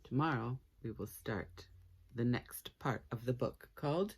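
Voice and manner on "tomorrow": usual speaking voice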